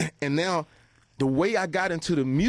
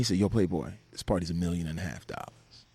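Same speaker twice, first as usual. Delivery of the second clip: deep mock host voice; 7 semitones lower, 4 dB quieter than usual. Host voiced in retold story.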